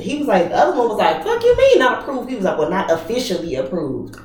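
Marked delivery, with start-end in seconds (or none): 1.2-2.3 much higher-pitched mock disbelief; incredulous retort. Acts out other officer's angry disbelief. 2.6-4.2 lower, softer mock excuse; sheepish backpedal. Acts out officer's sheepish answer.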